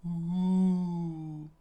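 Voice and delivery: high, sustained howling ooh; wind noise. A narrator's reading. Narrator imitates the wind around the giant, howling. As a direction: hoot like wind, long and smooth. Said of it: "the wind going".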